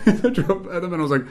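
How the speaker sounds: ordinary talk, nothing marked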